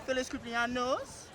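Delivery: soft Spanish birthday wish, "Feliz cumpleaños" said the Spanish way. Shown as a demonstration. Showing off his Spanish to the crowd, "Feliz cumpleaños" said softly in Spanish. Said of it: "I'm about to say it in Spanish".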